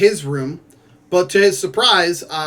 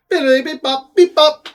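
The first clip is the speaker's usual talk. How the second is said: high, playful beeping noises; silly robot bleeps, rising louder; robot beeps; 9 semitones higher, 5 dB louder than usual. Pretend. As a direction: chirp it like a little robot.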